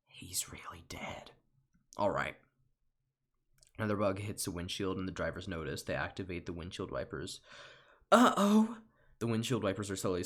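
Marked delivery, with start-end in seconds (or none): 0.1-1.3 slow hushed whisper, no voice. Acted. Bug whispering over a dead friend. 8.1-8.8 much higher, loud alarmed cry. Acted. Bug crying out as the wipers start.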